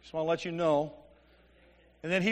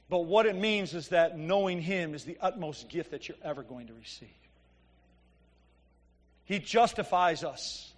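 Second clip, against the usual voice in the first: normal talking voice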